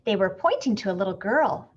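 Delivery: ordinary delivery, nothing marked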